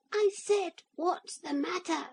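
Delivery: very high, tiny piping voice; small squeaky toy voice; little voice. A narrator's reading. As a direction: speak as a tiny wooden man. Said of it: "said the little voice".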